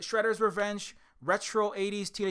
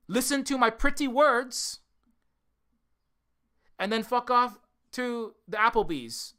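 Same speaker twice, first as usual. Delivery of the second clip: mocking; 3 semitones higher, 4 dB louder, slower than usual. Real, not acted. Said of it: "if I don't say it like that".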